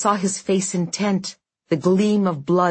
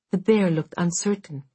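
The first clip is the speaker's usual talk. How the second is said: ordinary delivery, nothing marked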